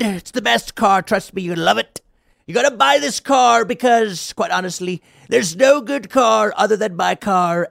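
loud, high, pushy car-salesman put-on; hammed-up smarmy pitch. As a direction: lay on the hard sell, smarmy and overconfident. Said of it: "he's all smarmy and says".

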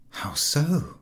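breathy, puzzled whisper. Acted. Friend puzzled, whispering the question. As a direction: whisper it, taken aback.

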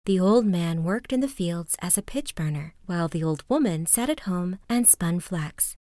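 ordinary delivery, nothing marked

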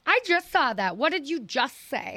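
high-pitched mock confrontation; imitated comedian's challenge. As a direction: call someone out, mimicking her up high. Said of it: "No, she goes".

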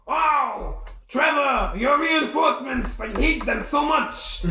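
high, strained character shout; acted outraged yell. Enemy hit by Firebolt complains to Trevor, high outraged yell. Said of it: "and he's like".

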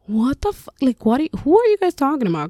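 high, fast, baffled outburst; stunned disbelief. Retold or imitated. Mom reacting to daughter calling her a hero, stunned disbelief. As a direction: blurt it out, can't believe what she hears. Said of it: "And I'm sitting there like".

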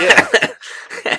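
high, loud mock-incredulous yeah; a drawn-out sarcastic yeah, as if you don't buy it; sarcastic disbelief. Mocking disbelief at CEO claim.

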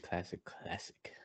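whispering it softly. Real, not acted. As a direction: breathe the words out. Whispered repeat.

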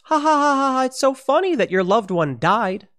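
high, cruel fake laughter; sneering mock laugh then an indignant retort. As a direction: cackle as if mocking a death. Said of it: "the L A Times laughs".

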